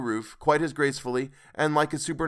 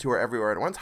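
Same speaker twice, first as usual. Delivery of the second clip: ordinary delivery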